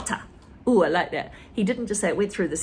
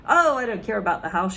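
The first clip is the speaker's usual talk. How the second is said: mild shout; 5 dB louder, faster than usual. Acted. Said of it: "she shouted".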